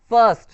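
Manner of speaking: फर्स्ट said loud and clear with a short "uh" vowel, "furst"; pronunciation demo. Shown as a demonstration. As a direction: model फर्स्ट crisply with a neutral "uh" sound. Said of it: "यहाँ पे अ प्रोनाउंसिएट हो रहा है ना".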